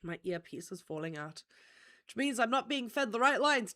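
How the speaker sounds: ordinary talk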